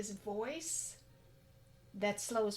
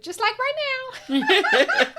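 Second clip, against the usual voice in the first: loud, high laughing burst; laughing about forcing herself to be extroverted; 15 dB louder than usual. Real, not acted. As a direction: laugh as you catch yourself.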